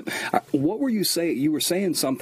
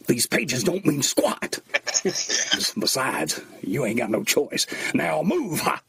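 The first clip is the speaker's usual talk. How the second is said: movie line quote; 5 semitones higher, slower than usual. Retold or imitated. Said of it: "that part where you go".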